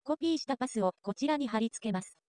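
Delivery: ordinary delivery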